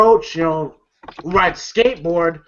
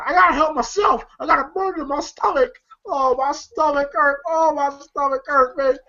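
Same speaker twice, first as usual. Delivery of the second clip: high, quick pained whine; whining in pain; 6 semitones higher than usual. Retold or imitated. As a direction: whimper about a sore stomach.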